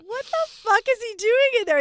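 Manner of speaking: can't believe the noise; wife reacting to moaning in the shower; exasperated disbelief. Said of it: "I'm like".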